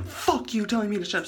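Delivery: high-pitched mock defiance; rebellious retort. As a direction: imitate the man's indignant comeback. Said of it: "So now he's all rebellious".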